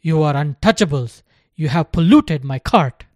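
loud contemptuous shouting; angry yelling. A narrator's reading. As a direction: keep yelling in disgust. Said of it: "yelled the cart driver".

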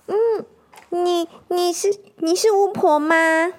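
put-on high stammering child voice; squeaky-high hedgehog, hesitant; nervous hedgehog kid. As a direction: ask if she's a witch, scared.

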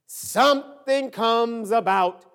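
loud, rising preacher's cadence; full-force preaching. Real, not acted. Pastor tells congregation nothing goes perfect, preaching at full force. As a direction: build each repeated phrase, loud and insistent.

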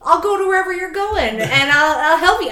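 loud, high gung-ho offer of help; mock over-helpful Canadian; over-eager helper. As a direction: blurt it out, far too eager to help.

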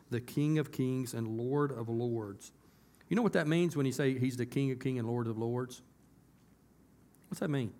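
usual speaking voice

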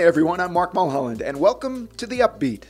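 ordinary talk

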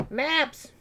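high, swooping comic character voice; Beldor impression. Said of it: "Y'all have heard my Beldor".

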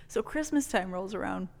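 ordinary talk

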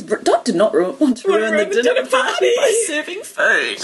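laughter-squeezed reading, rising high; laughing. Real, not acted. Re-reading a funny card to the audience, laughing. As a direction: struggle through the line while giggling.

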